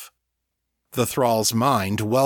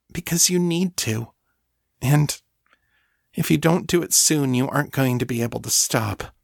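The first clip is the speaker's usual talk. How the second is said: higher, halting, pleading voice; urgent thrall; 5 semitones higher than usual. Acted. Thrall warning her mistress to feed, urgent. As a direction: warn her earnestly, pitched up.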